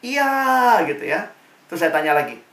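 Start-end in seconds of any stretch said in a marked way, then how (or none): 0.0-0.8 drawn-out, higher answer of a student group. Retold or imitated. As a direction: imitate a class chorusing yes. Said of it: "Mereka jawab".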